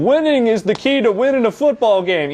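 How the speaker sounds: loud, high-pitched mock commentator; booming send-up of a glib football commentator; Madden. Mocking Madden's glib play advice, loud high impression. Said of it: "when you ask Madden for play advice".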